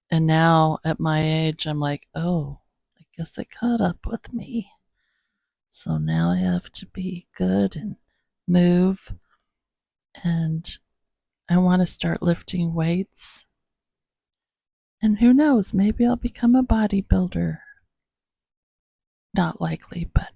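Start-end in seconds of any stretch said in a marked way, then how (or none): 2.1-4.7 breathy whispered aside to herself; whispering. Retold or imitated. Whispered inner thought. 19.3-20.1 whispering. Real, not acted. Self-deprecating aside.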